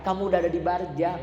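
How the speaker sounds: ordinary delivery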